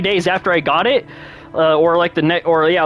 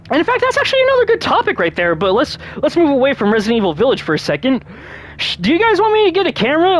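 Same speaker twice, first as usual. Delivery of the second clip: excited topic jump; 6 semitones higher, faster than usual. Real, not acted. Sudden excited topic switch.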